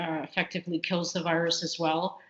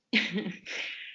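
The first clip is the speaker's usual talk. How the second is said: quiet, breathy little chuckle; stifled, unvoiced-sounding snicker; involuntary chuckle; 4 dB quieter than usual. Real, not acted. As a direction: let a soft laugh slip out before answering. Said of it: "I don't know why I'm laughing".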